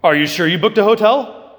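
wife Mary needling husband Joseph about the hotel, mock annoyed. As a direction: poke at him, playfully accusing. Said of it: "Mary could have been very annoyed by Joseph".

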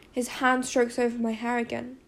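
ordinary delivery, nothing marked

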